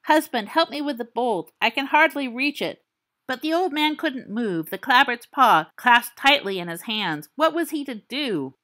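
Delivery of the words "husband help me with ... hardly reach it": a hint of a desperate old wife, raised pitch; calling up the stairs, a little frantic. Old wife calls her husband to the door, mildly frantic.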